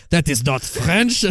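slow, hammed-up French accent; mock French accent. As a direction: imitate a scandalized French person, drawn out. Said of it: "They're like".

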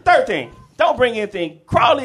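normal talking voice

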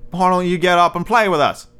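high-pitched storybook rabbit voice; wild rabbit character. A narrator's reading. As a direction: pitch it up as an eager playmate. Wild rabbit invites toy to play.

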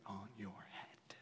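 whispered, mock-romantic confession; mock whisper to wife. Husband whispering to his wife Christine, mock tender. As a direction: breathe it to her like a secret. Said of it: "I'm telling Christine".

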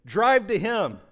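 put-on boy shouting directions at adults; son's call. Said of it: "feels the need to be like".

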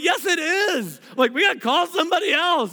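rally for backup; excited insistence. Retold or imitated.

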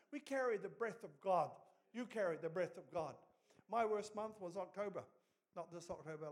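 ordinary talk, nothing marked

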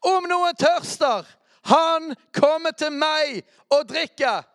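slow, high, full-voiced proclamation; shouting Jesus' call from the temple feast; Jesus crying out. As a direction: cry it out to the crowd. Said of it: "sto Jesus og ropte ut".

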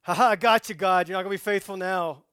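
hammed-up gotcha taunt; mock gloating. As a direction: crow as if you caught someone out. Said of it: "You know, it's like".

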